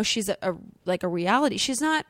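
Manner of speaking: ordinary talk, nothing marked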